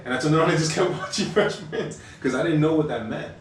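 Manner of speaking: real amused laughter while telling it; laughing. Real, not acted. Laughing at awkward memory.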